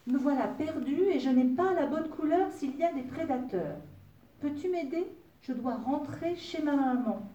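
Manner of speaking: high, loud little complaint; pleading little fairy. A narrator's reading. Lost fairy asks the wolf for help, pleading.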